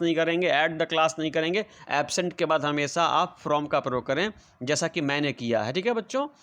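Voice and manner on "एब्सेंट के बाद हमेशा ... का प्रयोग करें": ordinary talk, nothing marked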